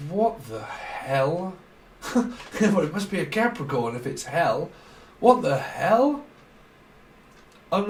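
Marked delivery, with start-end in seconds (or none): 0.1-1.5 drawn-out puzzled voice. Retold or imitated. Voicing the channeled message. 5.2-6.3 drawn-out puzzled voice. Retold or imitated. Voicing the channeled message.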